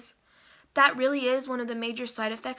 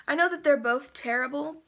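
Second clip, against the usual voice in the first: ordinary talk, nothing marked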